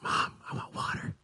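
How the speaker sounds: hushed, unvoiced little request; child's whispered plea. Kid asks mom for water at night, whispered.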